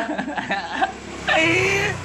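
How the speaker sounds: high-pitched, squealing laugh; mocking laugh. Real, not acted. Streamer taunting his opponents, high mocking laugh. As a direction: burst into a rising, jeering laugh.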